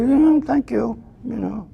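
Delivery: gentle, lingering thank-you; tender thanks. Shown as a demonstration. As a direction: thank the mind like soothing a child. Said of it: "So you".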